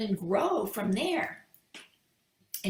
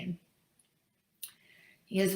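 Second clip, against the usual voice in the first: ordinary delivery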